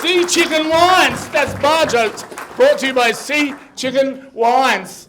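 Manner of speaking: loud, slow advert plug; sponsor announcer. Real, not acted. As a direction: project the sponsor's name to the room.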